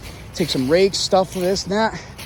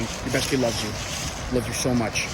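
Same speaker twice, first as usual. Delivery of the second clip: tender; 5 semitones lower, 6 dB quieter than usual. Real, not acted. Tender message to his best friend.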